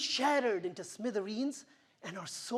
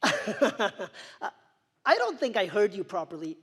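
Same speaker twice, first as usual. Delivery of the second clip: nervous laugh; 8 dB louder than usual. Retold or imitated. Disbelief at the nurse's news.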